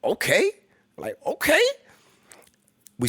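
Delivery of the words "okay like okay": stunned. Retold or imitated. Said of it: "And I was like".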